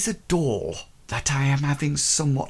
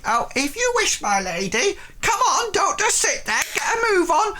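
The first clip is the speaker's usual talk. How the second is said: cook bossing servants. Acted. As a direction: shoo the servants along, high and bossy.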